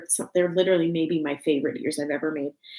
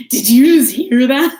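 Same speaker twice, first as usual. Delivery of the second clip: loud, higher excited Elf quote; excited Elf voice, much louder; Buddy the Elf; 6 semitones higher, 12 dB louder than usual. Retold or imitated. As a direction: blurt it like Buddy after a burp. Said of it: "he burps and he's like".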